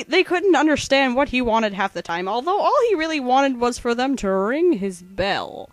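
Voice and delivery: ordinary talk, nothing marked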